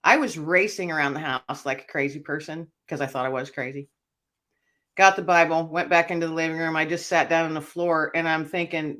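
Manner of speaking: ordinary talk, nothing marked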